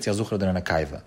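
ordinary delivery

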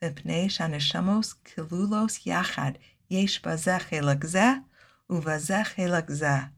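ordinary delivery

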